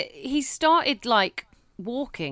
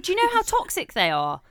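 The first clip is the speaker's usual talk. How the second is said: higher, faster telling-off; exasperated scolding; 6 semitones higher than usual. Retold or imitated. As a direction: scold mum, pace climbing at the end. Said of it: "I said".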